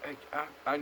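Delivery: ordinary talk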